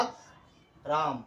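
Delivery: আকরাম very slowly as "ak", long gap, "ram"; syllable by syllable. Shown as a demonstration. Teacher sounding out a surname for students, syllable by syllable. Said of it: "সিলেবেলের সাহায্যে সঠিকভাবে উচ্চারণ".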